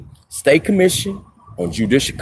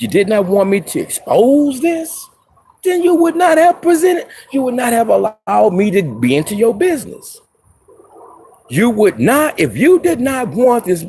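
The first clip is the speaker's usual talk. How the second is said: indignant rant; 6 semitones higher than usual. Real, not acted. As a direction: pitch climbing higher, pressing each accusation hard. Accusing officials of corruption.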